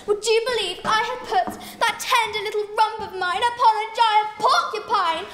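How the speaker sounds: ordinary delivery, nothing marked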